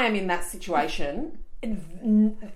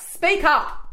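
mock loud speak up; 10 semitones higher, 4 dB louder than usual. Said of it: "I would just literally just be like".

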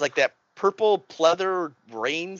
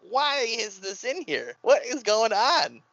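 baffled; 8 semitones higher, slower than usual. Retold or imitated. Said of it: "I was like".

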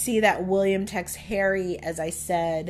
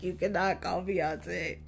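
ordinary delivery

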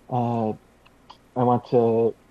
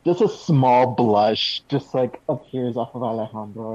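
ordinary talk

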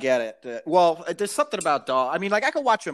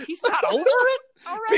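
mock incredulous. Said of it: "I'm like".

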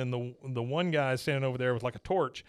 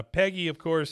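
ordinary talk

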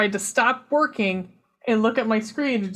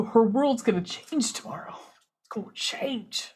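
hushed; 6 dB quieter than usual. Real, not acted. Dramatic hushed warning.

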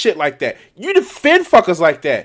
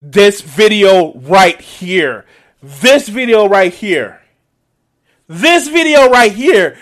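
this video right here, slow and building louder each time; slow building emphasis; 6 dB louder, slower than usual. Real, not acted. Pointing at the rival's video, slow and building louder. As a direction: lean on each word, slower and louder each time.

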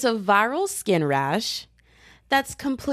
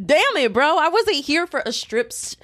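exasperated outburst; 8 semitones higher than usual. Retold or imitated. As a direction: blurt it out like you can't believe it. Exasperated quote at doctor.

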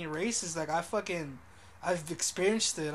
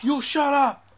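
loud, raised mock retort; imitated pissed-off man; 7 semitones higher, 11 dB louder, slower than usual. Retelling the man yelling at the lady, pissed off. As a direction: throw it back loudly as an angry man.

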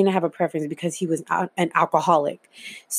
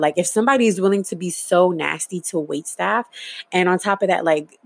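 normal talking voice

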